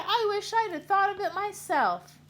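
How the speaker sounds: very high loud squeal; loud high excited shriek. Acted. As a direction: shriek with delight. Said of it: "shrieked Nebraska".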